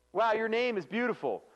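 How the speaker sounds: high, loud, impressed praise; admiring compliment. Acted. Trainer modelling a compliment to a coworker, openly admiring. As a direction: say it as a delighted compliment. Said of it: "if you really thought someone's name is beautiful".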